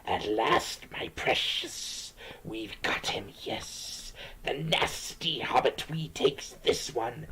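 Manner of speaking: soft, hissing whisper of a creepy creature; Gollum. Acted. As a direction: hiss and whisper it slowly, stretching the key words. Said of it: "It's a bad Gollum voice".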